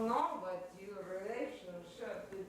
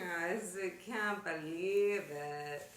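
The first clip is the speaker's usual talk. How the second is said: ordinary talk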